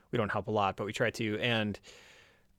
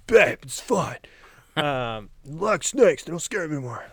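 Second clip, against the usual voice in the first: mock brave reassurance; 6 semitones higher, 6 dB louder than usual.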